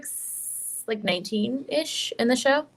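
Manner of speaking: normal talking voice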